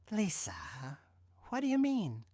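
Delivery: trembling question; shaking voice. Acted. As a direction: let the voice tremble. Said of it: "my voice shaking".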